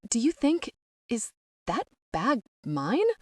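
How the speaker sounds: high, halting question with careful gaps; trying to sound nonchalant. A narrator's reading. Patient asking nurse about designer bag, trying to sound nonchalant. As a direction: pick out each word, feigning casualness. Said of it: "trying to sound nonchalant".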